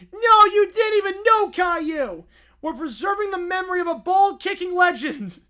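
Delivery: loud, high angry shout; indignant shout. Acted. Princess refusing the pig's application. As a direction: yell it in outrage.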